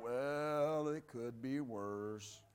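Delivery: very low, slow, glum drone; soft, dragging, downcast mock-gloom; Eeyore. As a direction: sink into a heavy, gloomy believer's voice. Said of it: "They're the Eeyore believers".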